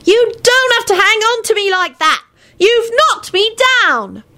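put-on high, put-out little boy yelling; child shouting. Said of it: "the sound of Teddy Lupin shouting".

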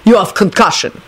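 put-on accented, mildly scornful diagnosis; nasty. A narrator's reading. Dragomir belittling dazed Lupin, nasty. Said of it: "Dragomir said nastily".

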